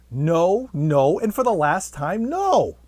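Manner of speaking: loud, high, fed-up frog shouting no; exasperated frog. Acted. Frog fed up with the repeated proposal, building to a loud shout. Frog loses patience, last refusal.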